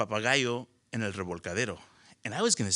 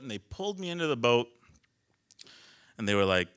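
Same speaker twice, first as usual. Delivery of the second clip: normal talking voice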